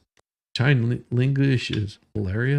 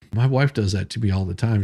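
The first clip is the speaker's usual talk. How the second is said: ordinary talk, nothing marked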